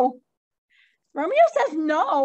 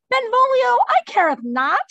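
higher, louder mock-theatrical reading; hammed-up Shakespeare line; 10 semitones higher, 6 dB louder than usual. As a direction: ham it up like a stage actor. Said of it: "Ah, I'll go ahead".

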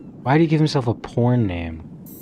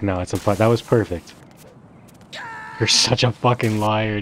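usual speaking voice

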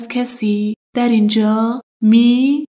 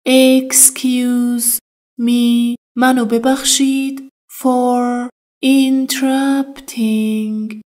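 slow teaching drill; slower than usual. Shown as a demonstration. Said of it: "Interrupting یعنی".

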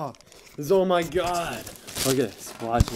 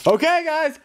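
hype announcer; 14 semitones higher than usual. Acted. Vlogger announcing clips to viewers, hyped. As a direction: sell it like a hype man.

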